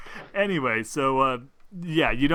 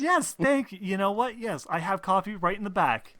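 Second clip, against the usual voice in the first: high, eager shopkeeper chatter; cafe owner voice; 5 semitones higher than usual. Acted. GM voicing the coffee shop owner to the players, high and eager. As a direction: gush like a too-friendly host.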